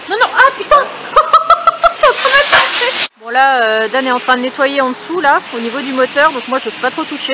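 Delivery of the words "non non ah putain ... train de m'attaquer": high, loud, laughing yelp; startled laughing shriek. Real, not acted.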